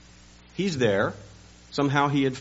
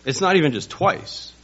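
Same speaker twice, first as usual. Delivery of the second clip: ordinary delivery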